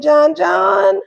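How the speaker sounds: ordinary talk, nothing marked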